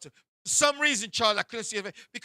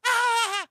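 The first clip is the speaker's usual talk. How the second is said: high, loud imitated cackle; hammed-up high laughing squeal; mock shrieking laugh; 12 semitones higher, 5 dB louder than usual. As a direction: burst into a piercing fake laugh.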